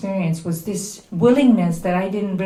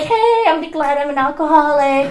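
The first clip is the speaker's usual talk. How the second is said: high, loud, breezy imitation; hammed-up happy-go-lucky member; mock carefree; 8 semitones higher, 9 dB louder than usual. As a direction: play blithely cheerful, way too loud. Mimics carefree, oblivious members.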